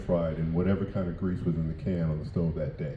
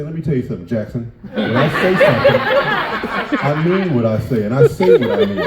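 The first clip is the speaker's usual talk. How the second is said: mock stern lecture; 6 semitones higher, 11 dB louder than usual. Comedian laughing as he mimics his mother's lecture. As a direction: boom it out like a laughing scolding mom. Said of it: "She'd say".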